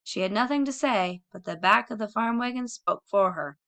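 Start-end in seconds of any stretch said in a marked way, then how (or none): none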